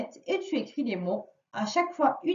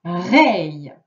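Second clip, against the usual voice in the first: loud dictation; 5 semitones lower, 9 dB louder, much slower than usual. Shown as a demonstration. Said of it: "C'est parti".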